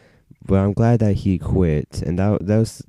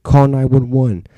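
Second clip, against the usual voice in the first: loud, high urgent plea; acted alarm; 6 semitones higher, 5 dB louder than usual. Friend urging help for a bad trip, acted alarm.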